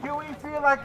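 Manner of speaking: slow, loud rally call; rabble rouser. Real, not acted. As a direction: drag out each line to whip up the crowd. Rally speaker working the crowd.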